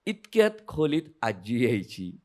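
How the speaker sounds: ordinary delivery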